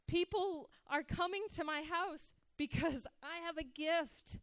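a lightly voiced, full-of-himself younger self; past self's proud thoughts. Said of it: "I thought".

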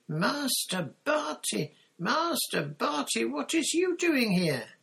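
shrill, very high elf wail; piercing shriek. A narrator's reading. House-elf Winky finding her master, piercing high shriek. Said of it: "let out a piercing shriek".

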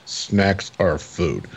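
imitating a straight-faced monotone. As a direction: say it in a drawn-out monotone, no expression. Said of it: "like, monotone".

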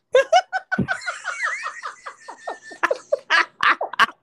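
bursting into long, helpless laughter; long real laugh. Real, not acted. Co-host laughs hard after admitting she can't swim either. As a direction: crack up at the shared secret.

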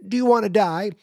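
high, loud hammed-up taunt; over-the-top threat; mock menace. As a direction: shout it like a cartoon killer. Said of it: "that question is".

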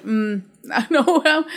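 a small nervous laugh, drawn out slowly; awkward laugh. Retold or imitated. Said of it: "I'm just like".

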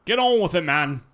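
impatient shout. Real, not acted. Friend shouting at the host to hurry up, loud impatience. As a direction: bark at him to get going. Friend stalling before reading bottle.

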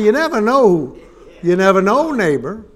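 preacher proclaiming to congregation; emphatic. Real, not acted. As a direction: drive the point home to the crowd.

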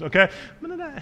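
scared squeak. Retold or imitated. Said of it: "the pitch of your voice goes up a few octaves".

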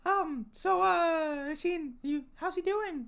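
high, hesitant character voice; high-voiced curious character. Asking after someone's uncle, high and hesitant. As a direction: fish for news in a high voice.

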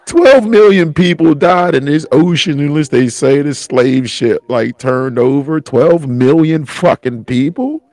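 upset, high-pitched tearful outburst; real distress, pitch pushed up; crying. Real, not acted. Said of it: "I'm crying like a bitch".

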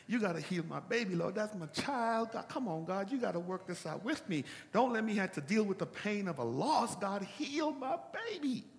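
put-on David begging God to spare his child; desperate plea. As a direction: beg God for the baby, rising to a cry.

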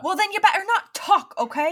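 high, loud telling-off; exasperated scolding. Acted. Player scolding a friend who can't do the accent, exasperated. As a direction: scold loudly, voice pitched right up.

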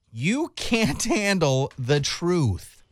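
"You can't handle the truth" stretched very slowly, word by word; "You can't handle the truth" over-enunciated, very slow; exaggerated mouthing. Shown as a demonstration. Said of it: "what you're doing with your lips".

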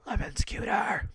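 whispered aside, unvoiced; conspiratorial whisper of Lemon's cuter. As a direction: whisper it like a secret. Whispered repeat.

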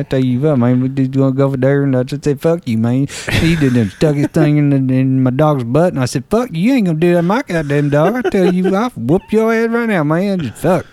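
just ramble, words slurred together; rambling Southern mumbler; Boomhauer. Shown as a demonstration. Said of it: "you just ramble".

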